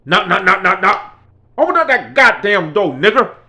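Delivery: loud, hammering door-knock calls; loud pounding demand. Retold or imitated. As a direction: bang on the door and yell to open up. Klan leader pounding door.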